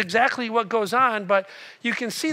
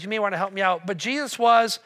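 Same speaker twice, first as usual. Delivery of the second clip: usual speaking voice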